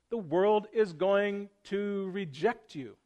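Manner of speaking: slow, deliberate, every word weighed; "The world is going to reject you" set down slowly, word by word; said plainly. Real, not acted. Pastor warning the congregation plainly, slow and deliberate. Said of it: "Let me just say it plainly".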